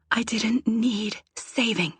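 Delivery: slow, resentful whisper; breath-only annoyed retort. A narrator's reading. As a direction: whisper it, spacing the words out in irritation. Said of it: "I whisper".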